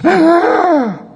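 loud, high acted gasp; sudden high intake of breath; reviving gasp. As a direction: suck in breath like coming back to life. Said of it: "And the guy goes".

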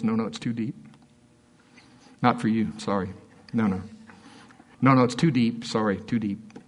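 low-key, dismissive brush-off; understated mock apology, unbothered; offhand refusal. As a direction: imitate someone coolly withholding a secret. [name] coolly refusing the onlookers.

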